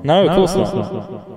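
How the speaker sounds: slow pitched voice filter; voice effect. Acted. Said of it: "Jesus Christ".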